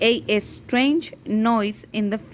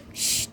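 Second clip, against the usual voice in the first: unvoiced "shh" for silence; a soft hush to the listeners; shushing; 6 dB quieter than usual. Hush acted in the story.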